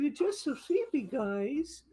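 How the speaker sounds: high cute dog voice; squeaky little puppy voicing. Owner speaking for her sleepy poodle in a high cute voice. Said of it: "He said".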